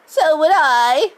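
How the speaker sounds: put-on high, loud, slow old woman's agreement; second grandmother joining the refusal; cry. A narrator's reading. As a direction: shout in stubborn support. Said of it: "cried Grandma Georgina".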